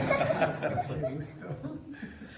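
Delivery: high burst of laughter; hearty laugh. Real, not acted. Laughing at his own joke. (carcajada aguda)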